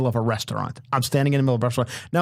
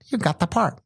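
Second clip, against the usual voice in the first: imitated producer, pitched up; Bob Wellborn; 6 semitones higher than usual. Producer giving the young actor the role, high impression. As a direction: announce it in the older producer's high put-on voice. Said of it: "He talks like this".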